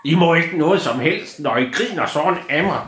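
a high voice with a hint of a sulky little goblin; offended little creature. Offended creature scolds the children.

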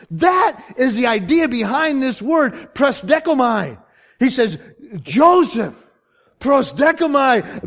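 high, loud, slow sermon climax; full-force preaching. Real, not acted. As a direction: drive each phrase home like an impassioned preacher.

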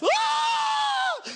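high-pitched mock scream; sustained comic shriek; panicked scream. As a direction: scream as if losing your mind over nothing. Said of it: "running around and losing their mind".

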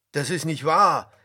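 loud outraged denial; indignant. A narrator's reading. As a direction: protest forcefully. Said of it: "riefen die Brüder empört".

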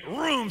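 high vroom of a starting car; cartoon engine roar; imitated engine rev. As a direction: rev it up with your voice.

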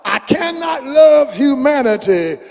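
rising, soaring preacher chant of the lyrics; preaching a song. Acted. Preacher reciting a song to the congregation, soaring chant. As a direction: build it up, let it swell.

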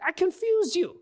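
high-pitched mock exasperation; acted scolding, voice jumping up high; exasperated. Jesus listing how he upset his disciples, exasperated.

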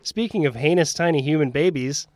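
usual speaking voice

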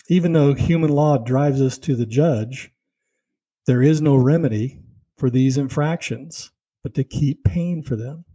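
ordinary delivery

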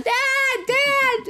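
very high, loud panicked cry; panicked screaming. Retold or imitated. Drowning girl screaming for her dad, high panicked shout. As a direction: yell for him as if going under. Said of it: "like, screaming and panicking".

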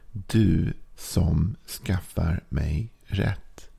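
very slow, "du" drawn out; stress on du. Shown as a demonstration. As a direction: dwell on "du", slowly. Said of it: "jag har strykit under du".

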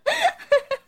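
high little bursts of mocking laughter. A narrator's reading. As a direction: giggle like teasing schoolgirls. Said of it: "some of them would laugh".

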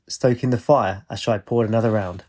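ordinary delivery

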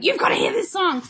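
eager, high and bright; rushing to share a song; excited. Retold or imitated. Excited quote to friend.

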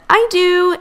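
high, loud, held-out reply; eager chorus answer. A narrator's reading. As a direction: pipe up eagerly, stretching the last word. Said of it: "Itty, Scout, and Wally all said".

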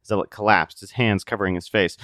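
ordinary talk

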